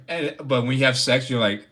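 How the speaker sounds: normal talking voice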